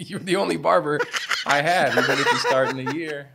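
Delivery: high and loud, laughing through it; laughing exclaim. Retold or imitated. Owner telling his barber he's hired, laughing loudly. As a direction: laugh as you insist he's your guy. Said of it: "I was like".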